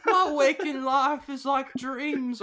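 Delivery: high, loud, drawn-out mock British; slow it down in a silly high British voice; hammed-up British impression; stupid British accent. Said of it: "they're doing a stupid accent".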